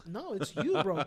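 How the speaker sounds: ordinary talk, nothing marked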